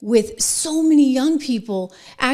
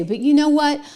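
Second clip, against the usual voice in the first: ordinary delivery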